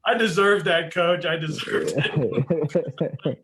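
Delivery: sheepish laugh. Retold or imitated. Sheepishly accepting the rebuke.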